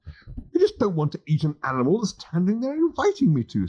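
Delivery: lightly voiced squeamish Englishman; flustered Arthur. Arthur character voice.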